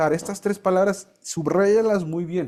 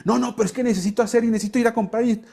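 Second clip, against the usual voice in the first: hurried protest; 5 semitones higher, faster than usual. Acted. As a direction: rattle off excuses as a mock objector. Acting out a busy objector.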